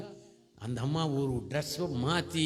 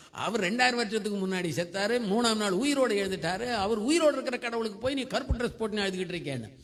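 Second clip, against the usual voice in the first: high, exasperated mock scolding; worked-up husband; 6 semitones higher than usual. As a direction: act out a tense husband correcting the wife. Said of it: "இவர் tension ஆயிட்டார்". (டென்ஷனான கணவன் திட்டுவது போல)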